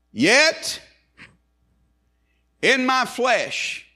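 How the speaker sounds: YET cried high and loud, then slow; ringing, drawn-out pulpit declaration; preached scripture. Real, not acted. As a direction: proclaim the verse, leaping up on the first word. Preacher proclaims scripture verse.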